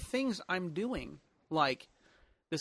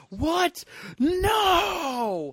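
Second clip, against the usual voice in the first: high, loud outraged cry; mock outraged shout; 14 semitones higher, 8 dB louder than usual. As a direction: yell it in protest. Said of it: "if he's listening going".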